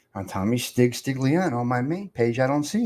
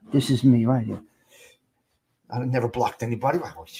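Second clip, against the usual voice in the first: ordinary talk, nothing marked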